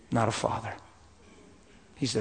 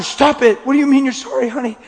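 high, loud voice through tears; tearful; 10 semitones higher, 8 dB louder than usual. Real, not acted. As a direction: gently stop her while crying.

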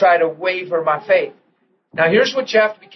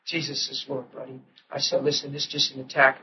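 soft soothing; 4 semitones lower, 8 dB quieter than usual. Retold or imitated. Father soothing son softly.

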